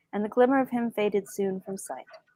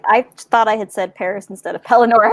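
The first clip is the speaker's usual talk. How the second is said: usual speaking voice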